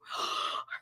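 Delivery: a soft unvoiced gasp of offence; mock offended gasp. Reacting to grandma's remark, mock offended gasp. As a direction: gasp as if wounded.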